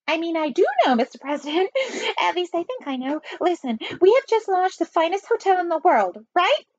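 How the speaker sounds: flustered high-voiced spy; falsetto. A narrator's reading. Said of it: "and a falsetto voice".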